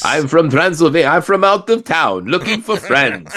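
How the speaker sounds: a playful mock vampire visitor; Transylvanian accent. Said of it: "Transylvanian accent".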